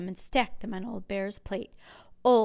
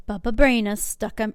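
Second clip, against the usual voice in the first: ordinary delivery, nothing marked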